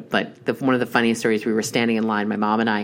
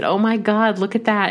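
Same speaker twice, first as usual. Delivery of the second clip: excited; 10 semitones higher, 3 dB louder than usual. Retold or imitated. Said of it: "I said".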